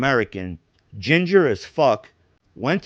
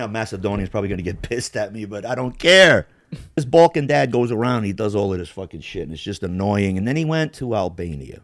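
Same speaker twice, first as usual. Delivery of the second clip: ordinary talk, nothing marked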